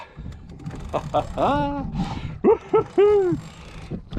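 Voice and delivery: high gleeful laughter; excited laugh. Real, not acted. Laughing at the catch.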